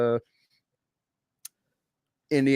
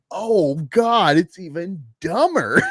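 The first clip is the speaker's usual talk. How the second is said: mock exasperation; 5 semitones higher, 6 dB louder, slower than usual. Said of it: "mock exasperated reaction".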